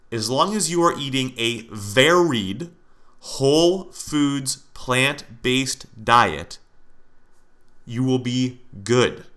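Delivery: very slow, each word spelled out for emphasis; slow, deliberate repeat. Shown as a demonstration. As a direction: drag out whole foods, plant-based diet so it sinks in. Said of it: "I wanna repeat that because you need to really listen".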